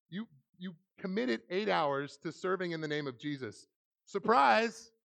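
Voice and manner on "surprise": high, loud reveal; spring it on them loudly; playful big "surprise"; mock surprise announcement. Springs the twist on imagined teens.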